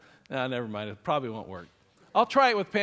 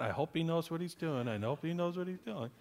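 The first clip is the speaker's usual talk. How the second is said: worried mutter; 2 semitones lower, 9 dB quieter than usual. Retold or imitated. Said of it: "I'm sure she's going".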